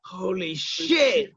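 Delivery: slow, drawn-out amazed curse; awed. Retold or imitated. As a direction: let the shock sink in slowly. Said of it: "And I was like".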